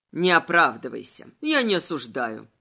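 low, deliberate villain voice; taunting villain incarnate. A narrator's reading. Incarnate confronting Adel over her deed, low and taunting.

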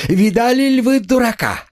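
very high, frantic jeer; hysterical mockery. A narrator's reading. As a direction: jeer to the whole room, near hysterics. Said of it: "истерически захохотала". (истеричная издёвка высоким голосом)

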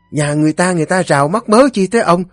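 very high, rapid nagging tirade; scolding wife. A narrator's reading. Wife scolds her drunken husband for picking fights, scolding. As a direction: berate him shrilly, barely pausing.

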